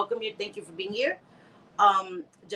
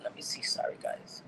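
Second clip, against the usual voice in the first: whisper; 9 dB quieter than usual. Real, not acted. Leaning into camera.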